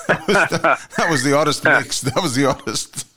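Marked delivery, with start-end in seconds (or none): 0.1-2.0 laughing, high and loud. Real, not acted. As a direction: speak through laughter. Laughing about old roommate.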